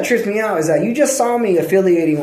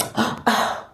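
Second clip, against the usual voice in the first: mock shocked gasp; 4 dB quieter than usual. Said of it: "someone's gonna be like".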